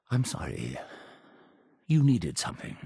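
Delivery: low, soft aside; subdued apology. Acted. Leader turning back to a visitor, quiet apology. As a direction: drop right down and collect yourself.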